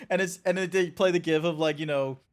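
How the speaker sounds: ordinary delivery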